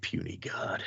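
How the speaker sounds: soft rough unvoiced growl, a lightly done monster; Hulk. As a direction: sneer it low like a brute. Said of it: "need Hulk here to be like".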